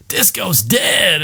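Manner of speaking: slow, high, mocking drawl; lightly voiced goon jeering; sneering thug. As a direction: drag it out to taunt him. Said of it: "just goes".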